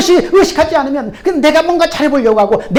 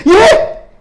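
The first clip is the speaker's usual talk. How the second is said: much higher and louder, aghast; shocked alarm; 9 semitones higher, 6 dB louder than usual. Acted. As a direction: recoil in horror at the command. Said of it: "소스라치게 놀랍니다".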